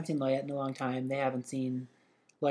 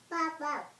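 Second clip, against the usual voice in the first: squeaky high pops; high cork pops; 5 dB quieter than usual. Acted. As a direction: pop it up high and cute. Cork sound for the baby.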